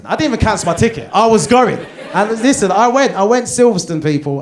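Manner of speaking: loud, fast, high and laughing; excited boast. Real, not acted. Comic boasting he went to the race, loud and laughing. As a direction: burst out proudly.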